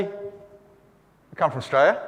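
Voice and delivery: "Australia" slurred down to "Straya"; slurred Aussie. Shown as a demonstration. Lecturer showing how Australians answer, slurred together. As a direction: swallow the first syllable of "Australia", blurring the rest. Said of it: "You say".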